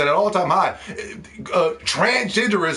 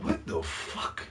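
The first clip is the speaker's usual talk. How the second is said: stunned whisper; 14 semitones lower, 13 dB quieter, slower than usual. Retold or imitated. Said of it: "You're like".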